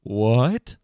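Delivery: loud, rising disbelief; retold stunned outburst; shocked what. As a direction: hit it like the floor dropped out. Shocked reaction to missing Dan.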